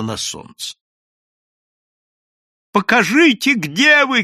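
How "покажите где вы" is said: high, loud call over the crowd; shouting. A narrator's reading. Ioann shouting to the crowd, loud and high. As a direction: yell to find him. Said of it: "крикнул Иоанна Польский".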